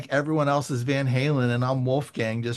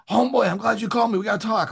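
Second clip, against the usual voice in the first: fast, higher-pitched imitation of an eager caller; retold urgent greeting; 6 semitones higher, much faster than usual. Joe finally reaching the nervous writer, imitated urgency. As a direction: rush in with the friend's greeting.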